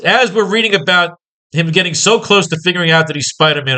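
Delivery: usual speaking voice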